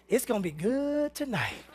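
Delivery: hint at it quietly with a wink; sly inner thought. Retold or imitated. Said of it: "I'm thinking".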